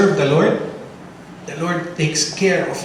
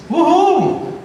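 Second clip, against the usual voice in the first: cheer; 12 semitones higher than usual. Real, not acted. Celebrating baby boy.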